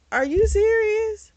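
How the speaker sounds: high-pitched, indignant disbelief; appalled, voice leaping way up; incredulous outburst. Real, not acted. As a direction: shoot up high in sheer disbelief. Incredulous rant at the character Joel.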